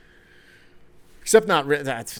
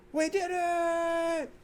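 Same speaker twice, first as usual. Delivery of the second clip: very high, very slow, softer squeal; mock Tatum celebration; 5 dB quieter, much slower than usual. Host mocks the star's trophy cry, high slow squeal. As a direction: drag it out in a tiny high squeak. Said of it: "is the new Tony Romo".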